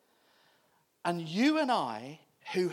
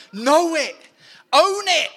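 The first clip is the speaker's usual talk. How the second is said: know it and Own it much higher and louder; loud urging; 11 semitones higher, 12 dB louder than usual. Shown as a demonstration. Preacher rallying church to own their identity, shouting. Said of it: "But actually".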